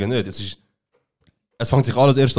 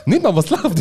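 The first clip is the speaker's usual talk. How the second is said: pitched up, incredulous retort; mock indignation; 7 semitones higher, slower than usual. Retelling his indignant comeback to the roommate. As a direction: throw it back, offended. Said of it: "ich so".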